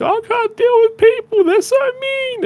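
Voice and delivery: very high, exaggerated tearful wail; mock crying. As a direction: imitate her crying down the phone in a squeaky voice. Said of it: "she'd call me at lunchtime crying".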